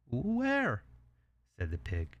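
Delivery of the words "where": high-pitched, questioning pig; curious little pig. Acted. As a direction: perk up in a small high voice.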